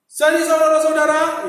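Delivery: loud, high public-address voice; ringing speech-opener volume; loud and clear. Shown as a demonstration. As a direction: project it strongly to a whole crowd. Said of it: "suara harus lantang, ya, kencang, keras".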